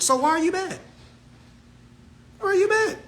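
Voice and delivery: high sing-song taunt. Real, not acted. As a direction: needle them, pitch way up. Taunting repeated question.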